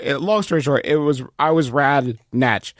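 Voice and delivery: usual speaking voice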